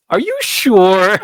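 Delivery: high, slow, drawn-out doubting voice; doubting cashier. Retold or imitated. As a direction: stretch out the doubt. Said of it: "and she goes".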